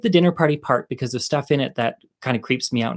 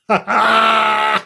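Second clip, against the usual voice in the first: loud real laugh; 8 semitones higher, 5 dB louder than usual. Real, not acted. Laughs at own punchline.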